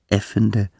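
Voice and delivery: usual speaking voice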